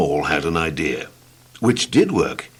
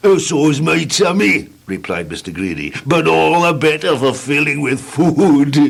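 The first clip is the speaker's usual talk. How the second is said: jolly Mister Greedy; 6 semitones higher than usual. Acted. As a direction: relish the food.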